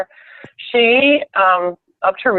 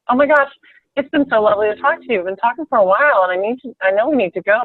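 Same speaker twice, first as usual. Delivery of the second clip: higher, quick retold sweet talk; friendly gushing; 4 semitones higher, faster than usual. Retelling how she wound up a long call, friendly gushing. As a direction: gush politely, pitch lifted, words hurrying along. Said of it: "But I said".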